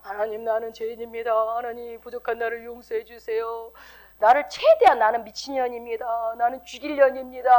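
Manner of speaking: droning, wailing monotone confession; self-flagellating prayer. Acted. Believer back at church begging God's forgiveness, self-flagellating prayer. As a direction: chant it on one note like an overdone penitent. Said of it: "자아 비판을 해야죠".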